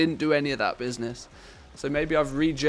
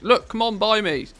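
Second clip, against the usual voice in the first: pleading; 7 semitones higher, 5 dB louder than usual. Real, not acted. Urging an auction buyer.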